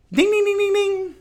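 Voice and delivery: high, loud sing-song ding ding; mock buyer chime. Mocking crystal buyers celebrating a scoop, high loud sing-song dings. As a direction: chime it out like an excited shopper. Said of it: "these people really be like".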